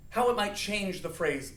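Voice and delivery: normal talking voice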